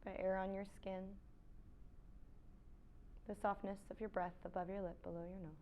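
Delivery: soft, unhurried yoga-teacher voice with long pauses; quiet meditation cues, spaced out; guided meditation. Real, not acted. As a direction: guide them gently into stillness.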